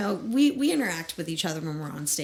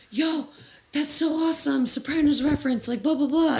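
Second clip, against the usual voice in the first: high, louder, impressed shout-out; excited kid fan; 9 semitones higher, 5 dB louder than usual. Retold or imitated. Kid praises the band's Sopranos reference, excited and high. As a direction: gush like a fan who caught the reference. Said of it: "this kid comes by, and he goes".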